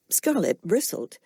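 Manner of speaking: ordinary delivery, nothing marked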